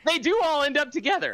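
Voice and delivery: high mock-innocent claim; sarcastic. Real, not acted. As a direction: say it with a sly sarcastic lift. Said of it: "he is totally being sarcastic".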